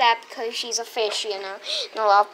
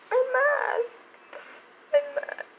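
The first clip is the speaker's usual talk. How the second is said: high distressed cry over mask; panicked; 12 semitones higher, 3 dB quieter than usual. Real, not acted. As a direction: wail it in a panic. Panicked about her mask.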